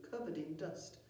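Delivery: ordinary delivery, nothing marked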